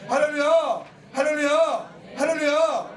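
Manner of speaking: ringing hallelujah, called out; loud hallelujah. Real, not acted. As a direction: rouse the congregation. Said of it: "calling out to congregation". (힘차게 외치는 할렐루야)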